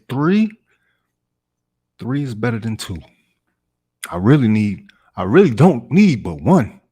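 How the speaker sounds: incredulous, high and loud, then bargaining; Floyd Barton. Acted. Auditioning actor argues as the character, emphatic bargaining. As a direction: punch the key words with leaping pitch.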